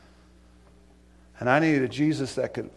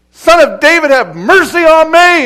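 yelling; 14 semitones higher, 16 dB louder than usual. Retold or imitated. Blind Bartimaeus cries out to Jesus for mercy, loud desperate yell. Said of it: "He was yelling".

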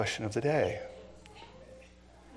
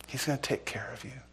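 tender reassuring whisper; 9 dB quieter than usual. Real, not acted. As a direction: whisper comfort to them. Reassuring congregation softly.

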